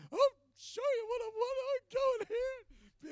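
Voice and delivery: high, loud tearful shouting; bawling yell. Retold or imitated. Man retelling how he yelled at God, bawling. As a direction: wail it out at full voice, acting it out. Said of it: "bawling my eyes, yelling at God".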